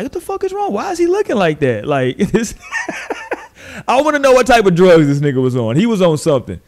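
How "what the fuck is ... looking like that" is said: shocked disbelief. Retold or imitated. Host reacting to [name]'s stare, high-pitched disbelief. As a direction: leap way up in pitch on the first words. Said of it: "And I was like".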